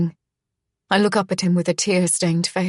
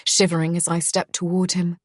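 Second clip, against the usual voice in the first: ordinary delivery, nothing marked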